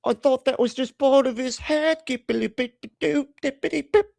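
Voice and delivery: high silly voice melting into babble; squeaky mock voice into nonsense; mock gibberish voice. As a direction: ham up a clueless fool then babble.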